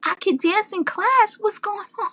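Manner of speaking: high, laughing, delighted disbelief; giddy retold question. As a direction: squeal it out, half laughing at yourself. Retold own excited question, laughing.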